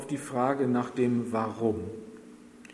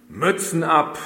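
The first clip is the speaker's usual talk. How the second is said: loud, higher bellowed order; barked command; 4 semitones higher, 7 dB louder than usual. A narrator's reading. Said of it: "brüllte der Lagerchef".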